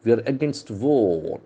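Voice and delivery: normal talking voice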